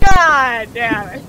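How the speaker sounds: slow drawn-out curse; frustrated groan. Real, not acted. Frustrated at losing the round.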